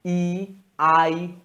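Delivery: ई, आई stretched very slowly, letter by letter; spelling English vowels. Shown as a demonstration. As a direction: drag out each English vowel name. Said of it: "उसमें हम लोग ए".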